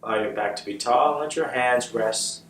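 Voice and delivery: calm, unhurried meditation guide with long pauses; measured, settled voice for leading meditation; guided meditation. Real, not acted. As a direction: guide them gently into the breath, leaving space after each phrase.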